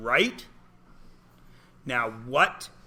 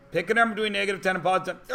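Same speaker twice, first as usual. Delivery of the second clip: rushed mumble; 4 semitones higher, much faster than usual. Pretend. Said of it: "I do the comedy".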